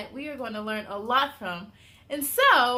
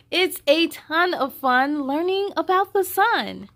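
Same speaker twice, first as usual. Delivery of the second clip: bright, louder storybook voice with higher lines; reading to children; 6 semitones higher, 7 dB louder than usual. Acted. Host reading the picture book aloud to young viewers. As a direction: read it out big and lively for little ones.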